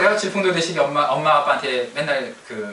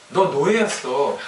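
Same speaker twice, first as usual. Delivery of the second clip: scornful retort; slower than usual. Acted. Mocking the grumbling Israelites.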